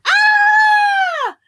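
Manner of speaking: very high loud shriek; scream. Acted. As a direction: scream in terror. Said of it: "I screamed and I hollered".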